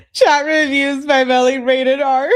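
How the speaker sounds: loud, high laugh-talk. Real, not acted. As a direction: burst it out through laughter. Reads chat while cracking up.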